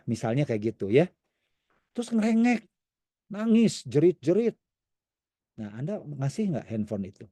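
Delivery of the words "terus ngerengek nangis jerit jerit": whiny describing. Retold or imitated. Describing child's whining.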